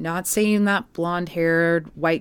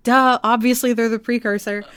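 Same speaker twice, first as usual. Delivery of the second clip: loud, higher, emphatic "duh"; mock-exasperated duh; 6 semitones higher, 6 dB louder than usual. Host reacting to hearing the grunge chords, emphatic duh. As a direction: state the blindingly obvious, loudly. Acts out own obvious reaction.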